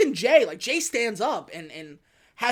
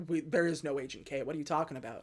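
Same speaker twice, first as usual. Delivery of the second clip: low, soft retold reply; understated quiet confusion; puzzled denial; 5 semitones lower, 9 dB quieter, faster than usual. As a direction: drop the voice, baffled and subdued. Retold baffled colleague's low denial.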